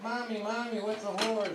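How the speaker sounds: put-on high little kid asking mommy; child voice. Little girl asking her mom in the car, high kid voice.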